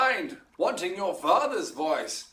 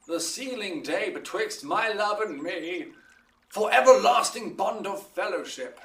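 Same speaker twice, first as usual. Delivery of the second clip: ordinary delivery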